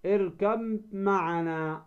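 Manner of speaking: slow "اركب معنا" as irkam-ma'ana; ba merged into mim. Shown as a demonstration. Teacher repeating the merging example, اركب معنا with ب turned into held م. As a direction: hold one long m from اركب into معنا. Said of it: "الباء في الميم".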